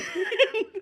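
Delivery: high-pitched burst of laughter; real laugh. Real, not acted. Teller laughing at the customer's old slang, real high laugh. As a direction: crack up for a moment. Laughs at his own word.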